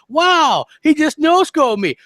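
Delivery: high, loud, slow disbelieving shout; Hellhammer impression. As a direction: yelp like you just got sniped. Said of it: "This is how he sounds".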